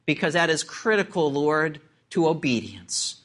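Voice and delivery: low, subdued praying voice; closing prayer. Real, not acted. Preacher asks God to speak to every heart, hushed prayer.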